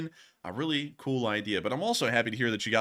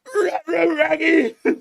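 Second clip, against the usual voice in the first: high laugh; 9 dB louder than usual. Real, not acted. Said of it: "my voice is cracking".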